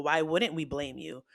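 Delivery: normal talking voice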